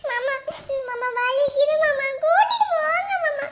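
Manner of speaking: tearful calls of pain; pained pleading. Acted. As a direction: beg him to take you, whimpering. Wife begging husband for hospital. (வலியில் கணவனை அழைத்து கெஞ்சுதல்)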